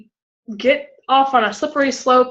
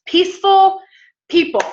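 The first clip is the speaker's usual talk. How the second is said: emphatic proclamation; 9 semitones higher, 5 dB louder than usual. Real, not acted. Preacher to congregation, announcing the key, loud and high.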